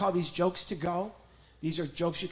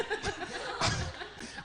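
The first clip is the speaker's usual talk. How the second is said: high puppet giggle; 6 dB quieter than usual. Acted. Puppet laughs.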